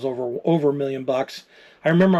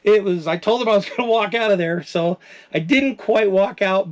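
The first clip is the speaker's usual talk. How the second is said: emotional; 6 semitones higher than usual. Real, not acted. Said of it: "I'm getting a little emotional here".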